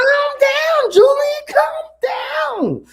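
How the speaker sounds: hammed-up squeaky plea to chill; shrill-pitched mock whine of calm down.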